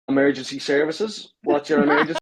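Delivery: put-on a Northern Irish accent, rising, holding back a laugh; mock Northern Irish operator voice. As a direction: imitate the Northern Irish call handler, half laughing. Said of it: "the Northern Ireland accent".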